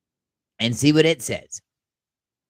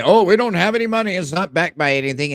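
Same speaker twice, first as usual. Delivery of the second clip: higher, louder mocking voice; mocking quote; 7 semitones higher, 5 dB louder than usual. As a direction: parrot the doubters with a sneer. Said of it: "you hear the same people say".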